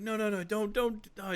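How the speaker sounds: urgent pleading. Acted. He begs her not to cry, urgent pleading. Trying to stop friend's crying.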